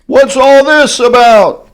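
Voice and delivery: high, loud curious shout; crowd shouting. Acted. Circus crowd rushing in, high loud shout. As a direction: call out in wonder. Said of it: "the people came shouting".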